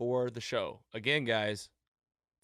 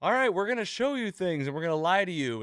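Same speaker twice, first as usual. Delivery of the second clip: higher, louder stage-magician spiel; lightly hammed-up emcee; mock showman patter; 7 semitones higher, 5 dB louder than usual. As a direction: announce it to the crowd with mock grandeur. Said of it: "he's like".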